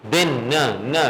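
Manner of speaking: the ن of دن repeated in short bursts; teacher drills the final ن for students; isolating the n sound. Shown as a demonstration. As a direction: hum the ن sound again and again. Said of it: "ایک طریقہ یہ ہے لبا دن".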